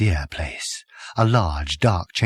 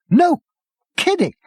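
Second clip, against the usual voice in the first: high yelp of surprise; 5 dB louder than usual. Acted. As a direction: squeak it out. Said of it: "he yelped".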